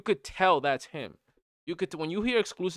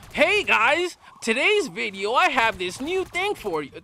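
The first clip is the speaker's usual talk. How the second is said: high, louder car-salesman pitch; mock sales voice; 7 semitones higher, 4 dB louder than usual. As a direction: parody a YouTuber selling hard, voice pitched way up. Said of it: "As soon as I hear that sales voice".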